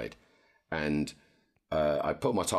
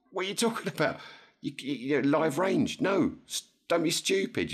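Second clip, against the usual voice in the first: high-pitched, disbelieving scold; a retold rant, high and incredulous; exasperated retort, much higher; 12 semitones higher than usual. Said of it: "I said, like".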